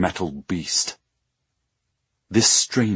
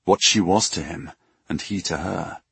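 usual speaking voice

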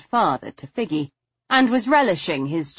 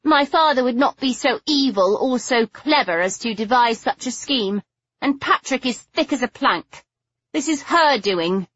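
higher, bitter and spiteful; 7 semitones higher than usual. Acted. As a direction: spit it with contempt. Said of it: "Figgy fairly spat".

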